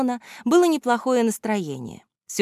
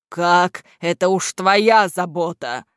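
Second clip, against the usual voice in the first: snapped, mildly gruff reply; curt dismissal; 4 dB louder, slower than usual. A narrator's reading. Boss dumping the task on henchman, curt dismissal. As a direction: shut the question down. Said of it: "отрезал Кляксич".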